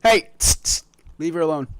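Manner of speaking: sharp loud call to stop. Real, not acted. Streamer calls out to his pet, loud and sharp. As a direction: call out to make the little one stop.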